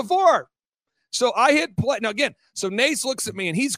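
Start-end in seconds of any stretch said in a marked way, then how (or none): 0.0-0.5 "Before" punched high and loud; emphatic repeat. Real, not acted. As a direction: hit "Before" hard and high to insist.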